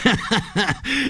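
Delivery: high wicked cackle; gloating high-pitched villain laugh; evil ghost laugh. A narrator's reading. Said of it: "laughed the ghost".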